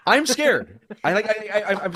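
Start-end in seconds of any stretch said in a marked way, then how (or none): 0.0-0.8 high, loud laughing repeat of scared. Real, not acted. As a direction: laugh through it at his own cowardice. Laughing at his own fear.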